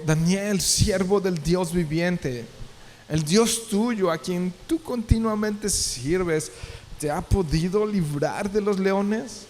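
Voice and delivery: high, slow and pleading; a king pleading at the lions' den; anguished plea. Acted. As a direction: ask it slowly, dreading the answer. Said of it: "con voz triste".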